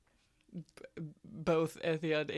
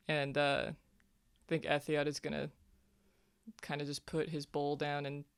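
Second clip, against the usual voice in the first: normal talking voice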